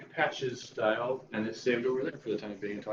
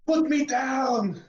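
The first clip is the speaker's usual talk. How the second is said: high, drawn-out play-acted yell; mock shout; 13 semitones higher, 4 dB louder, much slower than usual. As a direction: cry out in character, high and slow. Acted cry of a character.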